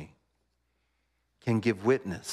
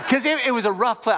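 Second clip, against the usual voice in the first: laughing through the words, louder and higher; 14 semitones higher, 6 dB louder than usual. Real, not acted. Pastor laughing to congregation about the rough bar. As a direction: burst out laughing as you say it.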